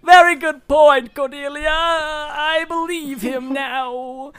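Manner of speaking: high, slow aristocratic voice; grand and gullible; pompous Reginald. Acted. The GM voicing Reginald asking the rich man his name, high and pompous.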